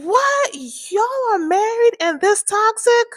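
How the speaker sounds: high, loud astonished outburst; can't believe what she's hearing; shocked disbelief. Retold or imitated. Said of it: "I was like".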